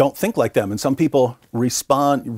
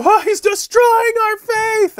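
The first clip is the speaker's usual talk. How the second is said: very high, slow wail; hammed-up distress, drawn out in falsetto; mock wailing; 4 dB louder, slower than usual. Muslims leaving a debate in alarm, mock wailing.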